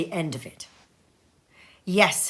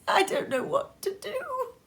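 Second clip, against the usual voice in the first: laughing and crying. A narrator's reading. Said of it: "laughing and crying in the same breath".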